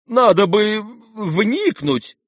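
high, drawn-out, slow plea; appealing for help. A narrator's reading. Husband urges wife to intervene, appealing for help. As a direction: plead for support, stretching the words. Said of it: "обратился он к супруге за помощью".

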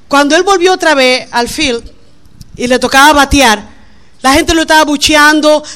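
ordinary talk, nothing marked

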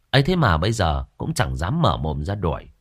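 plain reading voice

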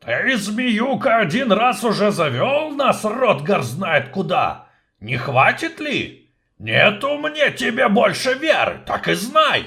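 high, loud grumbling dwarf; gruff dwarf. Acted. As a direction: scold the snake as an angry dwarf. Dwarf voice in the story.

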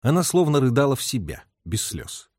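ordinary delivery, nothing marked

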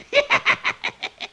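breathy, unvoiced witch's cackle. Witch cackles at the friends after her spell works, breathy cackle. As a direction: cackle under your breath.

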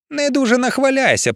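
high, tight warning; strained threat. A narrator's reading. Emiliia answering her rival's boast, strained threat. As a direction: force it out through gritted teeth. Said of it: "процідила Емілія".

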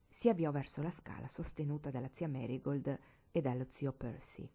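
ordinary delivery, nothing marked

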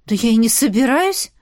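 ordinary delivery

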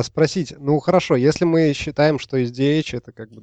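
ordinary delivery

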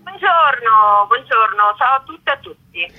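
calling out to the crowd; projected hello over the square; loud greeting. Real, not acted. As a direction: activist greeting listeners at a sit-in.

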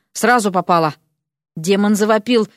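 ordinary delivery

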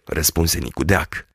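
ordinary delivery, nothing marked

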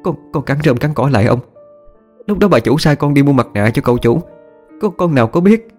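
high, stammering servant begging for mercy; acted frightened begging, pitched well up; pleading. A narrator's reading. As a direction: plead tearfully with an angry master. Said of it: "thì chỉ biết cúi đầu van xin".

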